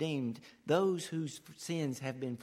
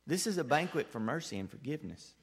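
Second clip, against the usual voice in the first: usual speaking voice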